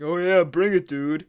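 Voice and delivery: mock-eager, sarcastic dare. Friend answers a fight challenge, imitated sarcasm. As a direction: imitate a friend who doesn't take the threat seriously. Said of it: "Like, being sarcastic".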